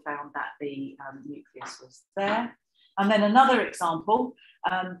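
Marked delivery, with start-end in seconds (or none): none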